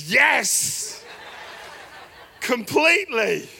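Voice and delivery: loud laughing yes, drawn out on completely. Retold or imitated. Father answering the friend's question with a delighted laugh.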